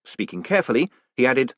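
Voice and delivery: usual speaking voice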